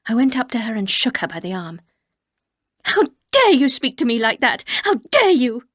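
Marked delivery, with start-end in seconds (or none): 2.8-5.7 loud indignant outburst on dare; how dare you, raised and furious; angry. Acted. Narrator confronting Mrs Danvers, loud and angry. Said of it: "I was suddenly very angry".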